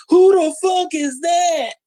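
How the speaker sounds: high, slow shouted outburst; bewildered fan yelling; mock shout. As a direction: yell it like a stunned crowd. Imagined crowd reacting, shouting.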